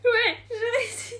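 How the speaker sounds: high, giddy laughing cheer; dizzy, high-pitched celebration; laughing triumph. Real, not acted. Dizzy after spinning, cheers success.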